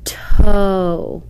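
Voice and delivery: Toe stretched out slowly, sound by sound, unvoiced; drawn-out whispered Toe. Shown as a demonstration. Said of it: "The O E word should be read like this".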